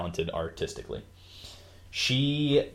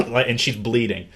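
ordinary talk

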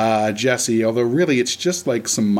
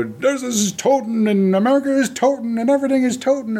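high-pitched mock German accent; hammed-up German-accented speechifying; fake German spiel; 10 semitones higher than usual. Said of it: "this whole weird fake German spiel".

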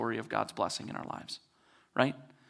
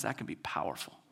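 hushed voice of God; 7 semitones lower, 9 dB quieter than usual. Retold or imitated. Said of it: "God looks at them and says".

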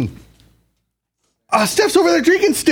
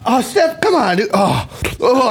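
ordinary delivery

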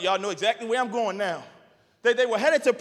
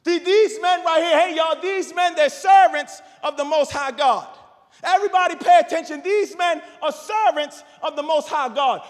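loud high calling out to the crowd; raised, fast cry of a possessed girl; shouting slave girl; 4 semitones higher, 5 dB louder than usual. Retold or imitated. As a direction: belt it out like a girl crying after them.

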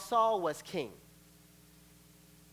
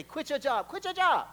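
rising, pushy repeated order; two friends nagging the same line; insistent friends; 8 semitones higher, 5 dB louder than usual. Acted. Friends sent by God to the believer, insistent.

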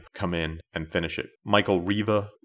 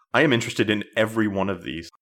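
normal talking voice

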